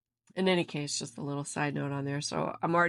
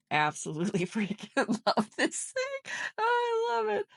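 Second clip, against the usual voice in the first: excited; 13 semitones higher than usual. Real, not acted. Squealing with laughing joy.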